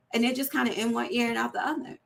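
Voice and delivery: ordinary talk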